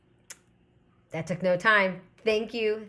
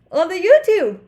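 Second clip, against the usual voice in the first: yawning through words; 11 semitones higher, 11 dB louder than usual. Real, not acted. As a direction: say it mid-yawn. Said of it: "I'm so sorry for yawning".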